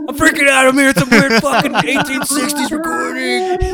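high, loud panicked voice; freaked out. Real, not acted. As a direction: shriek it out in real panic. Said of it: "You're that high".

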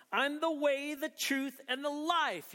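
high, ringing declaration; emphatic proclamation. Acted. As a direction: proclaim it as Jesus, pitched high. Proclaims Jesus' famous claim.

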